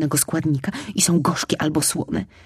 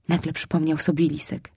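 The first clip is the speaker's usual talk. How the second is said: plain reading voice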